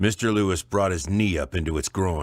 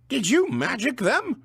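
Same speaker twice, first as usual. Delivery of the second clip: high squeak; squeaky voice. Acted. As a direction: squeak it out nervously. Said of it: "squeaked Alderman Sheedy".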